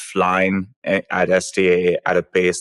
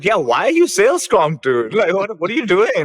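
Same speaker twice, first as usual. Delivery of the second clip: mock incredulous retort; 9 semitones higher than usual. Cohost replays her incredulous reaction to Sam. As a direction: toss it back like a ribbing buddy. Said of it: "I was like".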